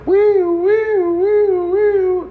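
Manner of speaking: high, wailing siren noise; imitated ambulance siren. Storyteller mimics the ambulance he heard coming, high siren wail. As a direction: wail "wee" like an approaching siren. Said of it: "and I hear".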